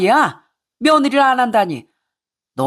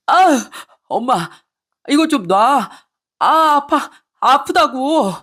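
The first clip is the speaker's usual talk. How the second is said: slow then higher pained pleas; pained whining; 6 semitones higher, slower than usual. Acted. Son whining in pain as his mother beats him. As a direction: whimper that it hurts.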